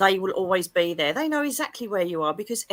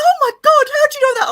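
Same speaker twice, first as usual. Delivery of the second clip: much higher and louder, astonished cry; amazed shriek; 12 dB louder, much faster than usual. Retold or imitated. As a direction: shriek it like she can't believe it. Friend's amazed reaction retold.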